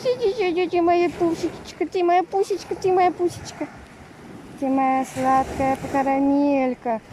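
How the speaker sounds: talking to a dog. Real, not acted. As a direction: gush over the pet. Cooing over dog Max.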